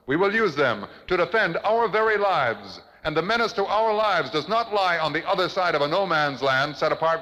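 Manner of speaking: triumphant rabble-rousing speech; rallying climactic oration. Acted. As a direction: rouse the crowd, climbing with every count.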